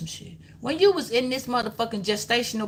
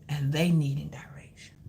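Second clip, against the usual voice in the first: whispered aside; 6 semitones lower, 5 dB quieter than usual. Real, not acted. Whispered echo of previous line.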